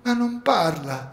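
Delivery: put-on trapped girl telling the rescuer about her sister; small child's voice. A narrator's reading.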